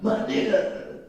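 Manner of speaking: same greeting said under the breath; whispered aside. Retold or imitated. Teacher repeats the slang greeting as a hushed whisper. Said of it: "We don't talk like that".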